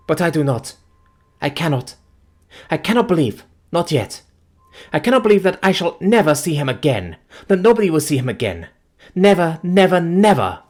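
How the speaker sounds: high, loud, breaking denial; desperate grief. Acted. Fiancée refusing his death, desperate. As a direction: refuse to let him be gone.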